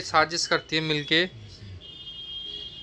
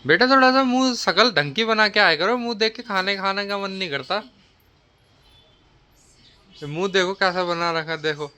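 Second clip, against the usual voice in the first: higher-pitched nagging elder; mock scolding; 5 semitones higher than usual. Mock mother-in-law scolding the daughter-in-law over face and lateness, nagging. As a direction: play at telling off a young in-law.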